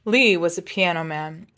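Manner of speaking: usual speaking voice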